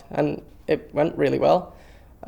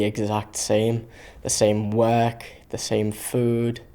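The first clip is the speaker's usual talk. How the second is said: slow and weary; 2 semitones lower, slower than usual. Acted. Monotony of camp life.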